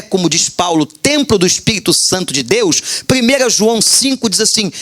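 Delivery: usual speaking voice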